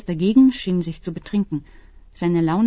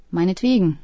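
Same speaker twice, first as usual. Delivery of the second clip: plain reading voice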